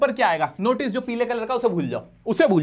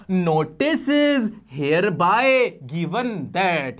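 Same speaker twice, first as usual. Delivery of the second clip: very slow, stretched-out word by word; slow dictation; 3 semitones lower, much slower than usual. Shown as a demonstration. Teacher gives students the stock opening line, slow dictation. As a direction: dictate it with every word drawn out.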